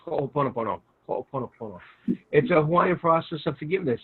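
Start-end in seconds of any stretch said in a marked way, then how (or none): 0.1-0.8 Ho'oponopono run together quickly; real fast. Shown as a demonstration. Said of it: "you can say it real fast". 1.1-1.8 Ho'oponopono rattled off quickly, softer; real fast. Shown as a demonstration. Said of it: "you can say it real fast".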